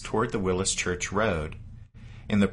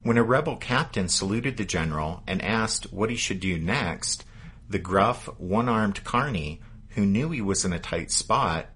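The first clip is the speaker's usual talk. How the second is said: ordinary delivery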